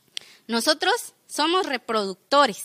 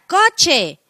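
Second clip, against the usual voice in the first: louder, drawn-out mock call; imitated insult call; 3 semitones higher, 5 dB louder than usual. Passing driver to a man in his car, mock shout.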